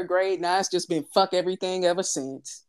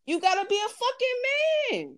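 emphatic high exclaim; 14 semitones higher than usual. Real, not acted. Heated rant on real men.

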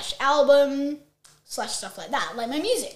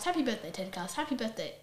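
trails off softer and softer; fading whisper; 8 semitones lower, 18 dB quieter, faster than usual. Acted. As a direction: let it fade to nothing. Repeat fades further.

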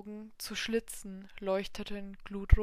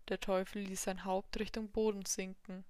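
plain reading voice

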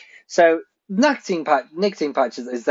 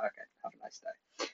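breathy whisper of a polite farewell; whispered have a nice day; whispered goodbye; 23 dB quieter than usual. Retold or imitated. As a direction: whisper it like a quick exit, barely there. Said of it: "I was like".

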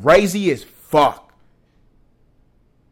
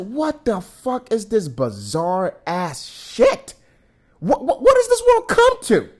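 disbelieving outburst; 6 semitones higher, slower than usual. Retold or imitated. Ranting to viewers about the podcaster, incredulous outcry. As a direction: throw up your hands in disbelief. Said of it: "And I'm just like".

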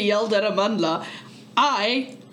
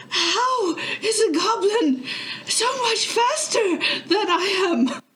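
high, slow, laughing yell; yelling in frustration; 11 semitones higher, slower than usual. Acted. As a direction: yell it out of breath, half laughing. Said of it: "I'm gonna yell".

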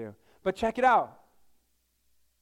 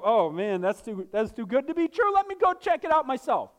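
mock doubtful men; 5 semitones higher, 4 dB louder than usual. Mocking men's disbelief.

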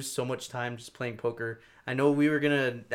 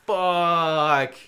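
loud, higher exasperated groan of a word; frustrated curse; 5 semitones higher, 10 dB louder than usual. Retold or imitated. Robbed player sees money vanish, loud exasperated curse. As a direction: let it out like you just lost everything.

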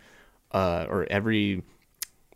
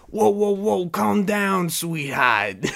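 hammed-up wiseguy voice, much higher and louder; mock Penguin mobster voice; 11 semitones higher, 6 dB louder, slower than usual. Vlogger mocking the Penguin's voice, in a hammed-up, much higher mobster voice. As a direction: ham up a goofy gangster bird. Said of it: "a penguin who's walking around going".

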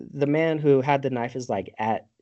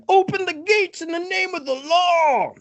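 imitated yell; 4 dB louder than usual. Retelling somebody yelling at the gates, mock shout. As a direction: holler it like a hammed-up town crier.

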